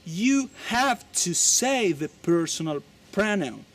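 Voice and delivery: slow, deliberate English rule; slow emphatic rule. Shown as a demonstration. Teacher insisting on the pronoun to students. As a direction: stress the rule slowly. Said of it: "en el inglés".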